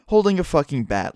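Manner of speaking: ordinary talk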